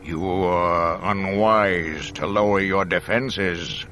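slow, loud villain's warning; drawn-out dark lord voice; Vader's warning. A narrator's reading. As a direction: warn him with slow, heavy menace. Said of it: "Vader warned".

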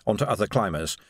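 usual speaking voice

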